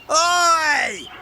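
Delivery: very high shrieking yell. Acted. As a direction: scream it from the top of the ladder. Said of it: "Gordon shrieked at the top of his voice".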